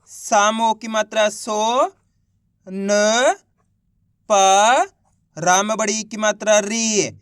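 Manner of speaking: सो, न, पा, री each held long and set apart; spelling out syllables. Shown as a demonstration. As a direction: sound out सो, न, पा, री slowly with pauses between. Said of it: "तो सा का उच्चारण कैसे करेंगे". (सो, न, पा, री को अलग-अलग खींचकर बोलना)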